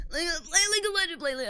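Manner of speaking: high, loud mocking friend voice; whiny imitation of League-obsessed friends; mocking League fans. Mocking friends pushing her to play League.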